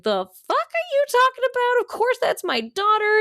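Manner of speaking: high-pitched mock outrage; pissed-off wife. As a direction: snap back fast in a very high voice, hammed-up. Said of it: "she's, like, pissed".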